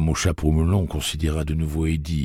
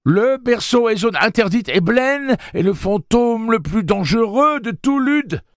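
high, loud, frantic warning voice; horrified warning; 5 dB louder than usual. A narrator's reading. Jeeves warning of the ghost Blaine, high and frightened. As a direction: warn them in terror.

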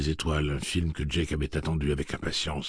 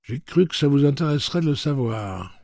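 knowing insinuation; 10 semitones higher, 6 dB louder than usual. A narrator's reading. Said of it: "d'un air de dire".

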